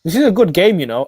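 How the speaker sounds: usual speaking voice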